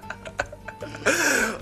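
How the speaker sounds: high laugh. Real, not acted.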